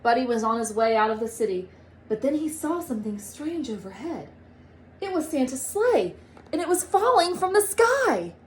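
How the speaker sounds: ordinary delivery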